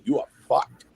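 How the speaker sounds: normal talking voice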